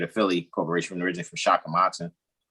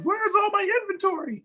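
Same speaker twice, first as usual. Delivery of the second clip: mock panic. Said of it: "It's like".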